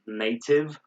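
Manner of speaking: "native" as NAY-tiv, crisp T; British pronunciation. Shown as a demonstration. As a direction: say "native" slowly with true T. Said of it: "They say hated".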